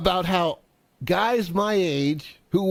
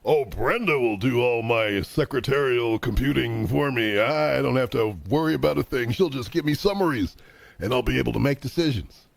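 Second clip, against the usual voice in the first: smug executive; 7 semitones lower than usual. Retold or imitated. Mocking old Sears executives.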